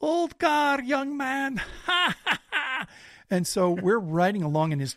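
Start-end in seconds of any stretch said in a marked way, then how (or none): 0.0-1.6 put-on high, loud, cheerful old Balkan man; jolly old man, much higher; chipper old man. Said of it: "he kept laughing at me, going". 1.6-2.8 put-on high, merry cackle of an old man; high chuckle in the old man's voice; jolly old man's laugh. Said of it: "he kept laughing at me".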